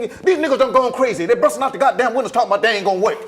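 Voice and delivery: loud indignant rant; brash character rant. Acted. Character storming in, loud indignant rant. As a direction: complain loudly to the whole room. Voicing August Wilson character.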